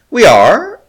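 high, loud gasping question; gasped. Acted. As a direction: gasp it in shock. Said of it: "he gasped".

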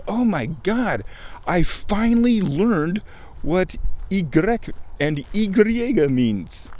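high, drawn-out awe at a discovery; educational buzz. Retold or imitated. Voicing the buzz of having finally learned, high awed excitement. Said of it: "riding this high, this educational buzz".

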